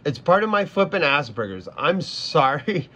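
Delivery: normal talking voice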